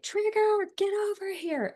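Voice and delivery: high, loud call to a dog. Retold or imitated. Owner at the open door summoning the dog, calling out.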